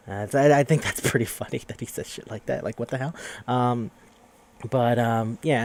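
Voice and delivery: ordinary talk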